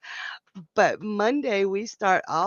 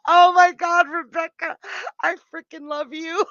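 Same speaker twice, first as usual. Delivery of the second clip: high, loud, helpless with laughter; laughing hard; 8 semitones higher, 6 dB louder than usual. Real, not acted. Streamer laughing at a viewer's joke. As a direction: gush through real laughter. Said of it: "I've got tears coming out of my eyes".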